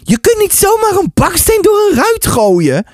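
high-pitched, exasperated objection; urgent protest. Acted. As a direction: protest in alarm to stop them. Narrator protests against throwing brick.

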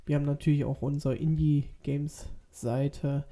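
normal talking voice

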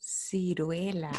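Ciruela said slowly and softly, each syllable apart; slow and clear. Shown as a demonstration. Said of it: "Say it again slower".

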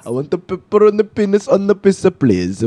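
high singsong fake Italian; hammed-up Italian accent; mock Italian accent. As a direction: plead like a cartoon Italian ordering food. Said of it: "Start doing that Italian accent".

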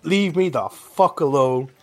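fed-up woman waving off pushy guards; annoyed retort. Acted. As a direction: drag it out like you're fed up being hassled. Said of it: "And I say".

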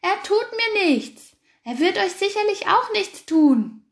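very high, loud triumphant shout. A narrator's reading. Hare held by the fox, shouting to the sheep. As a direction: shout it over the storm. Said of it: "schrie der Angsthase".

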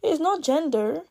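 lightly voiced skeptic brushing it off; a hint of a scoffing doubter; playful imitation of a viewer denying bias; mock dismissive viewer. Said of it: "some of you guys will be like".

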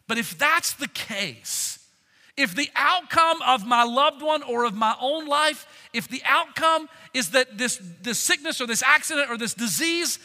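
a pastor pressing hope on a grieving flock; full-force preaching. Acted. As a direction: preach it out, building with conviction. Preaching builds on loved ones' deaths.

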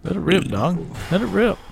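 cheering on. Real, not acted. Cheering on a farting dog.

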